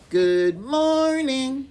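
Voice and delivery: high, slow drawn-out puppet voice; Turtle puppet. Acted. Turtle puppet greeting the class again, high slow voice. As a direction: greet the kids as a shy turtle. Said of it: "Let's try it again".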